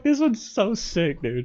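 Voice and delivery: amused. Retold or imitated. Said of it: "I was like".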